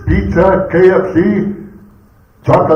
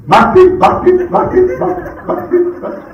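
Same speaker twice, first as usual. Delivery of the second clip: mock goose call masti, higher and softer; high goose honk fading to laughter; goose call imitation; 9 semitones higher, 4 dB quieter than usual. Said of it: "they sound like this".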